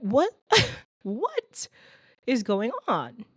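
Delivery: jumps way up, laughing in disbelief; high laughing double take; incredulous what. Real, not acted. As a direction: squeal it out in amused shock. Disbelief at apologizing to door-holder.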